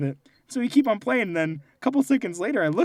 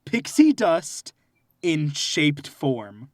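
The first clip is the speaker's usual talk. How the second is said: slow, drawn-out mock wonder; mock dreamy; much slower than usual. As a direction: savour each word slowly.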